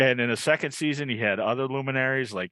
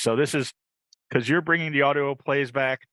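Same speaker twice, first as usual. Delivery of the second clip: usual speaking voice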